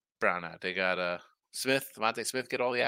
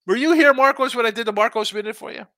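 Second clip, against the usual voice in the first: much higher, louder teasing outrage; mock disbelief; 10 semitones higher, 7 dB louder than usual. Real, not acted. Host teasing viewer Marcos for missing his segment, loud mock outrage. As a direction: playfully scold a viewer who missed it.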